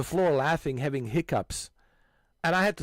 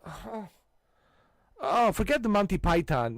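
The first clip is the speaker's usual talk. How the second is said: usual speaking voice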